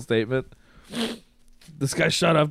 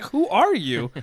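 high, incredulous question; retold disbelief, pitched way up; baffled; 9 semitones higher, 3 dB louder, slower than usual. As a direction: act out bafflement at a drunk mother. Baffled reply to drunk mom.

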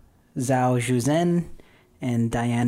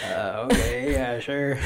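mock awkward uncertainty. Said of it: "does not know what to do".

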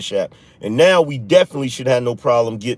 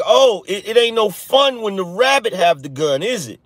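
mock whining; 7 semitones higher than usual. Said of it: "just incessant whining".

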